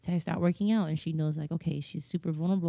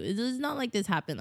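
ordinary talk

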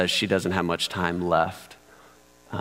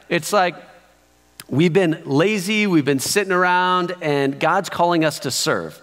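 higher, louder pulpit voice, pressing each point; preaching; 9 semitones higher, 5 dB louder than usual. Acted. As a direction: preach it out to the congregation with rising energy. Preaching the sermon proper.

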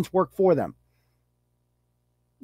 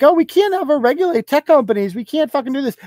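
mock fake Republican; 9 semitones higher than usual. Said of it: "who believes that, like".

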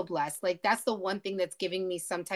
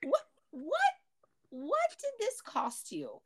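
high, disbelieving demand; incredulous; 11 semitones higher than usual. Real, not acted. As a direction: press the question in outrage. Repeats outraged question.

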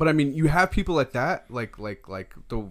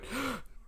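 quick, high demonstrated gasp; gasp for air; 12 semitones higher, 12 dB quieter than usual. Shown as a demonstration. Friend shows pulling the mask down, gasp for air. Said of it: "pulling the mask down, going".

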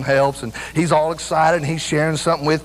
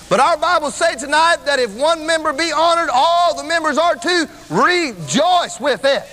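high, driving preacher's proclamation; emphatic preaching; 10 semitones higher than usual. Acted. Preacher telling the church what the Bible says, emphatic. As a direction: drive each point home with a stretched, high key word.

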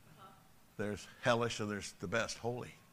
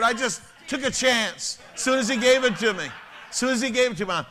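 much higher, much louder shout; excited shout; 8 semitones higher, 7 dB louder than usual. Real, not acted. Pastor shouts the punchline to the laughing crowd. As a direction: yell the punchline out.

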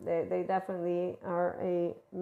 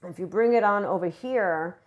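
ordinary talk

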